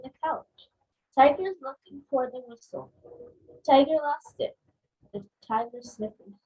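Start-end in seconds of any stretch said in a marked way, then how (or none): none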